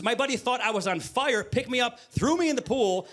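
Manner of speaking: ordinary talk, nothing marked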